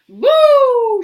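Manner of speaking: sudden high loud boom; loud boo. A narrator's reading. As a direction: burst it out like a bomb. Explosion sound effect.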